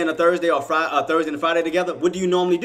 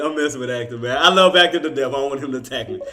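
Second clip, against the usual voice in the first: ordinary talk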